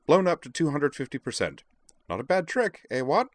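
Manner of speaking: high, chirpy character voice; self-satisfied Devlin. A narrator's reading. Devlin boasts of his enlarged map, high and pleased.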